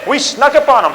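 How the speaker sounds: a proud general celebrating the crossing; gleeful general. Retold or imitated. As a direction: crow over the victory. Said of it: "the General Bragg says".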